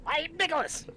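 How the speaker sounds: very high, pinched nose voice; nasal voice. Pretend. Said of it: "holding my nose like this".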